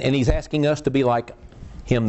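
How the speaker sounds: normal talking voice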